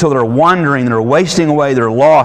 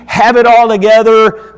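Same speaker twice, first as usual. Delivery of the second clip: ordinary talk, nothing marked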